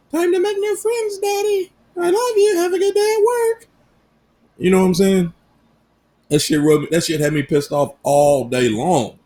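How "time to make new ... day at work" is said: put-on very high little girl voice; daughter's voice. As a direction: squeak it like a cheerful small girl. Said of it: "And I'm like".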